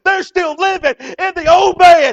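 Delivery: shouted, high-pitched sermon climax; preaching at full force. Acted. Preacher driving the message home, shouting.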